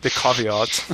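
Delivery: caveat as KAH-vee-aht in a light English accent. Shown as a demonstration. Co-host dares him, so he says caveat in mock English accent. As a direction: try caveat the English way, broad ah sounds. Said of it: "you have to say it with an English accent".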